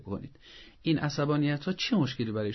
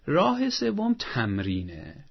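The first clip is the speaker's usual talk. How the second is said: slow announcement; much slower than usual. Real, not acted. Announcing the third method.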